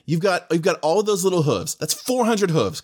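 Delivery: high-pitched, insistent debating; emphatic arguing. Real, not acted. Player arguing about a hundred tiny horses, emphatic arguing. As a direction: pitch it up to hammer the point home. Playful argument over horse fight.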